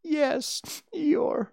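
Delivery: high, small, weepy piglet voice; high little piglet close to crying; tearful Piglet. A narrator's reading. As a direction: squeak it out near tears.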